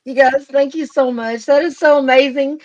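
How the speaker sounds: loud, high gushing thanks; excited thanks. Real, not acted. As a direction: pour out real excitement. Thanking fans for subscriber milestone.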